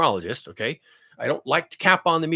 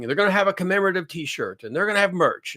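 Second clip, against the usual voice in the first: ordinary delivery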